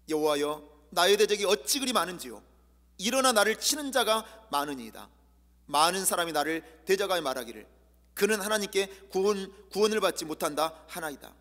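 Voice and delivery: loud, declaiming psalm; fervent scripture reading. Acted. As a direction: proclaim the verse to the church. Reads psalm with force.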